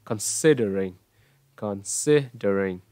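Considering stressed on SID, falling to the end; falling intonation. Shown as a demonstration. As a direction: hit "sid", then step down through Considering. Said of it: "Tiếng Anh là hướng đi xuống". (Considering, nhấn "sid" rồi đi xuống)

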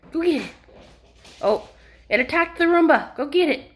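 high, loud excited dog-play voice; excited puppy talk. Real, not acted. Playing with puppy, excited baby talk.

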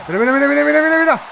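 high, excited rattle of repeats; excited urging. Real, not acted. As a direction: build excitement as the move comes. Excited as a move comes. (repetición muy emocionada)

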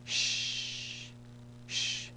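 Sh as a bare voiceless hush; just hush out the Sh; Arabic letter sound demo. Shown as a demonstration. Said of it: "letter sheen".

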